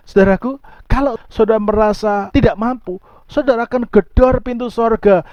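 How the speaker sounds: ordinary talk, nothing marked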